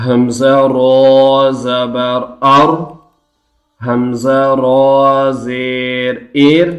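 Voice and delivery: slow drawn-out chant for students to repeat; slow chanting teacher; recitation drill. Shown as a demonstration. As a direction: model each syllable slowly. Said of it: "recitation drill".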